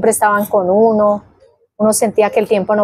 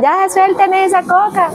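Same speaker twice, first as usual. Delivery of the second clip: mock scolding, pitched way up and slowed; scolding parents; 10 semitones higher than usual. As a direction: imitate a parent's high, drawn-out scolding. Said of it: "los padres nos regañaban". (imitando el regaño de los padres)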